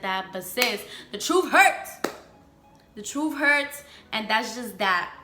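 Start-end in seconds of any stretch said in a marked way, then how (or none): none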